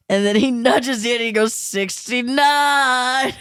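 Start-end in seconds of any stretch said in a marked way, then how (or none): 1.5-3.3 very slow, stretched-out mock punchline; drawn-out innuendo. Therapist nudging the husband with a crude joke, drawn-out innuendo. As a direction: stretch each syllable like a schoolboy joke.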